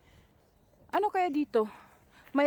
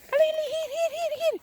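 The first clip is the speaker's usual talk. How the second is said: calling out; 14 semitones higher than usual. Real, not acted. Calling the dog or kids.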